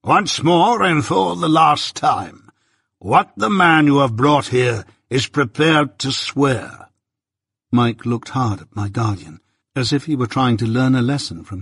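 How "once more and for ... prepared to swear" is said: slow, deliberate and stern. A narrator's reading. Jaggers sternly demanding an answer from Mike. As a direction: spell out each word as a last warning. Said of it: "said my guardian very sternly".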